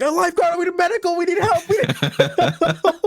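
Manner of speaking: high, loud, urgent call for help; frantic calling. Retold or imitated. Camp leader shouting to lifeguards for medical help, high and urgent. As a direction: yell for the lifeguard across the hall.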